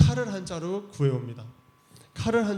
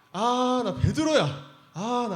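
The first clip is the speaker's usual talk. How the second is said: exasperated sigh; 8 semitones higher, slower than usual. Acted. Jesus sighing over Peter's mistake.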